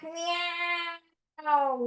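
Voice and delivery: long, drawn-out pretend meow; cat meow. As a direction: meow slowly like a stretching cat. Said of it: "ready for the cats?".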